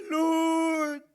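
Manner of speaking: high, loud wailing cry to the Lord. Acted. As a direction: wail it like a desperate leader. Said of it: "Umiyak na rin siya".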